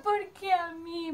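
slow, high, tearful plea; imitating crying. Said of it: "Me largué a llorar a mocote".

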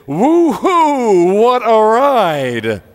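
high, loud, drawn-out whoop; exuberant scream. Acted. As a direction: holler it like the end of a wild ride. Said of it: "I want you to say".

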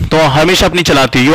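ordinary talk

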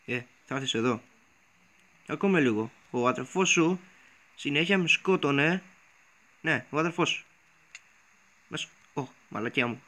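ordinary delivery

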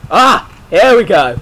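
loud, high outburst; startled yell. Real, not acted. Gamer as the fight starts, startled yell. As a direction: shout as the fight kicks off.

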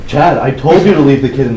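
put-on Johnny yelling at his roommate for bringing the kid; angry yell. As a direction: snap at him across the room. Scolding roommate across the room.

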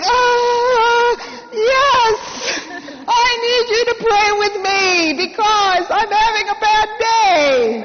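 strained, teary confession; pained admission. Retold or imitated. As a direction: squeeze out the admission as if it hurts. Forcing herself to admit need.

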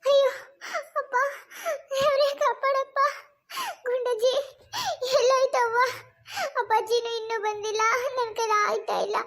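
acted moaning, broken by long pauses; in labour pain. Woman in labour waiting for her family, pained moaning. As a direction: plead through the pain in short bursts. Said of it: "ನಂಗ್ ಹೊಟ್ಟೆ ಬೇರೆ ಸಾನ ನೋವ್ತಾ".

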